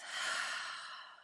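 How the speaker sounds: slow meditation voice with long pauses; guided meditation. Shown as a demonstration. Yoga teacher settling listeners into their props, slow guided meditation. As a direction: ease them into rest, unhurried and measured. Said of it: "Let it out of the mouth".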